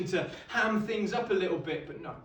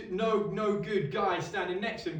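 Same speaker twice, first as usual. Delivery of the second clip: usual speaking voice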